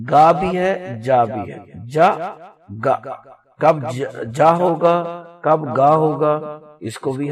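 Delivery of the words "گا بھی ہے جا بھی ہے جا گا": two sounds of the letter g shown; g sounds demo. Shown as a demonstration. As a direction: contrast "گا" and "جا" clearly. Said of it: "اس کے sound کتنے ہیں؟ ٹو".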